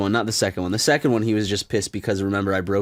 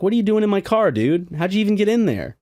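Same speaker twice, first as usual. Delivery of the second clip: higher, louder mock bewilderment; retold incredulous challenge; 10 semitones higher, 4 dB louder than usual. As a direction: act out baffled protest at a friend. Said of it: "I'm like".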